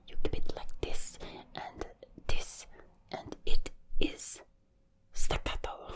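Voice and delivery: loud, punchy whisper in a German accent; staccato German accent. Shown as a demonstration. Showing how staccato goes, in a whispered German accent. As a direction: chop each word, whispering in a German accent.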